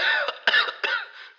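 loud hacking cough; fake cough. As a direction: cough hard and high. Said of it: "I have a bit of a cough".

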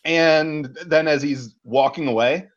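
ordinary talk

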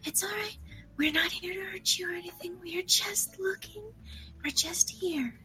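soft, unvoiced, gentle whisper; calm a scared animal, whispering; soothing whisper. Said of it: "soothing noises at the creature".

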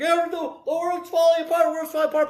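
high whiny mock panic; mock the churches wailing about doom. Preacher mocking churches that only complain, high whiny lament. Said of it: "A lot of churches are like".

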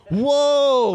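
high excited amazement. Real, not acted. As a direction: sound thrilled. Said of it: "where did you pick up this accent".

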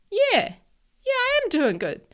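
high, loud excited reply. Retold or imitated. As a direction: squeal it happily. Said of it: "And I was like".

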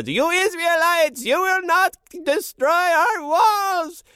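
very high, slow mock French taunting; French pea yelling insults from the wall, very high and slow; French pea accent. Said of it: "we've got French peas".

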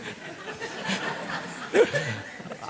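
breathy burst of real laughter; laughing at a shared glance with Jill. Real, not acted. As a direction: crack up, caught off guard. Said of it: "I shouldn't have looked at Jill".

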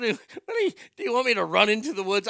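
hammed-up dad asking if he should run into the woods; high mock dad. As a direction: squeak it out like exasperated dad. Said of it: "When he said".

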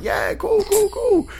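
high, strained "cool, cool"; uneasy fake-casual reply; awkward agreeing. Awkward small talk acted out.

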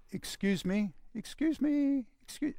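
politely trying to get attention, far too gently; mock-timid little "excuse me"; timid mock plea. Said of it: "It wasn't".

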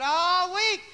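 very loud, high drawn-out imitation; mock squalling. Preacher imitating preacher's girl complaining of the devil, squalling. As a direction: wail it out, hammed up.

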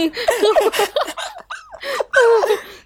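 long high burst of laughter. Real, not acted. Friend laughing at the pointless chat, high laugh.

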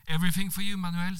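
high, flirtatious playful reply; with a twinkle. Acted. Monica flirting with Manuel, playful twinkle. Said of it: "sier jeg med glimt i øyet".

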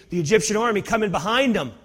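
ordinary talk, nothing marked

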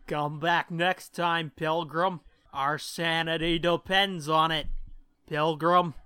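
ordinary talk

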